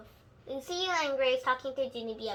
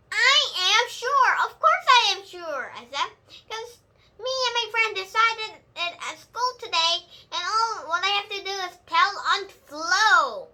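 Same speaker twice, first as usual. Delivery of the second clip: loud insisting; 6 semitones higher, 12 dB louder than usual. A narrator's reading. Junie B. insisting to Grandpa Miller, loud and certain. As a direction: plant your feet and insist.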